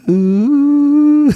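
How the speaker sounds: very high, loud cooing ooh; a squeaky, delighted coo as the mercury spills; mock high ooh. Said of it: "she's making, like, this sound".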